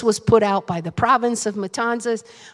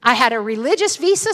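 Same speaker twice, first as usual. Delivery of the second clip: usual speaking voice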